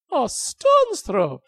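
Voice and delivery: high, slow scoffing disbelief; incredulous. Acted. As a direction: drag it out in scorn.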